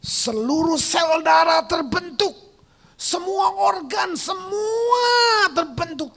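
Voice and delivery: high, loud climax with drawn-out words; exultant preaching shout. Real, not acted. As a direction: proclaim the miracle, stretching the big word. (seruan khotbah yang lantang, memanjang)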